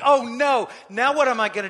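loud, panicked king caught out; David realising his plan has failed, loud panicked cry; panicked outburst. Retold or imitated. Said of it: "And David was like, expletive, cursing, angry".

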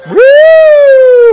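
high, loud held siren woo; siren wail. Retold or imitated. Preacher imitates an emergency siren for the congregation. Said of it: "How does emergency response".

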